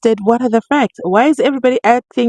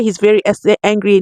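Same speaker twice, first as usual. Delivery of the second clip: ordinary talk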